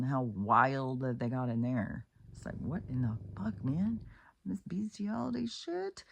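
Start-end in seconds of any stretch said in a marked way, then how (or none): 2.6-6.0 much higher, squeaky disbelief; shocked disgust. Real, not acted. Vlogger recoiling at the brothel story in high appalled disbelief. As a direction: pitch shoots up in appalled outrage.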